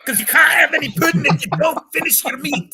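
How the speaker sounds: high, loud mock Scottish teacher yelling; shouting schoolmaster. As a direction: bellow it like a strict schoolmaster scolding. [name] mimics the Pink Floyd teacher.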